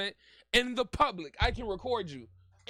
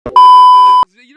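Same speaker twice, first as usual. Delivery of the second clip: slow, very loud yell into the mic; loud blast; 28 dB louder than usual. Real, not acted. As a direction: blast it out slowly. Shouting into the mic.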